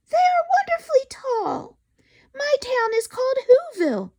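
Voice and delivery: the Who mayor calling up proudly; tiny mayor calling. Acted. Mayor's voice continues.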